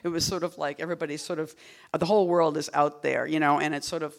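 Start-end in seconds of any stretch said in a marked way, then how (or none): none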